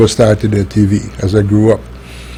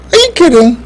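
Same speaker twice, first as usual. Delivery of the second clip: incredulous. Real, not acted. Incredulous rhetorical question.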